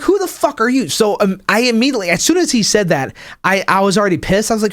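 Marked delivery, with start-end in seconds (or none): 0.0-0.9 higher, indignant retort; mock outrage. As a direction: fire it back, offended. Angry retort to the commenter.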